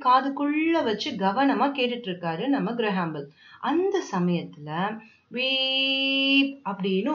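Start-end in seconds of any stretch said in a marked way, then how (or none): 5.3-6.6 long, monotone electronic beep; imitated beep. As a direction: hold a drawn-out "beep" on one steady note. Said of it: "ஒரு சத்தம் கேக்குது".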